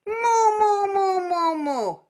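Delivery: high, loud nasal moo falling. Shown as a demonstration. Teacher demonstrating nasal moo for students. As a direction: call moo through the nose. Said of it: "Try it".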